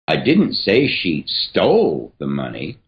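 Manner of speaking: didn't lifted with a rise; emphasis on "didn't"; stress on didn't. Shown as a demonstration. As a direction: lean on didn't. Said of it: "emphasis here is going to change every single time".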